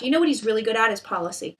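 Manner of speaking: usual speaking voice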